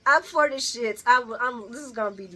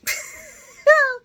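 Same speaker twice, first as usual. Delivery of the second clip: loud laughing burst; 11 dB louder than usual. Real, not acted. Laughs at the burn.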